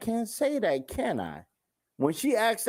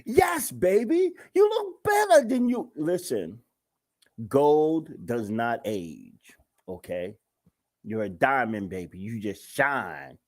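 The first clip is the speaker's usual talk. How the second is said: hammed-up flattery; 5 semitones lower than usual. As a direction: pour on the sugar to hide the lie. Husband flatters wife with a lie.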